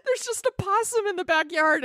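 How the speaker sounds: high giggly delivery; laughing while talking. Real, not acted. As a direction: say it through laughter. Laughing retelling punchline.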